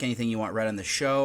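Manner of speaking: usual speaking voice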